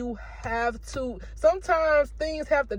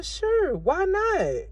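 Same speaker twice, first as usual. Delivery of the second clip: mock bright yes; 8 semitones higher, much slower than usual. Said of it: "So I was like".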